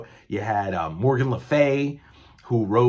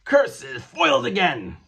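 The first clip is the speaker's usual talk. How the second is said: hammy supervillain losing; cartoon villain; 2 semitones higher, much slower than usual. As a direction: squeak out a mock curses foiled again. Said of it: "And the Mad Thinker's like".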